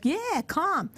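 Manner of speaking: high, delighted yes; eager welcome. Retold or imitated. Mother telling her child to come stay, eager.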